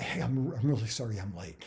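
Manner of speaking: slightly breathless, hurried apology; lightly acted out-of-breath apology. Shown as a demonstration. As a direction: rush it out, a little winded. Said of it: "I'm gonna be out of breath".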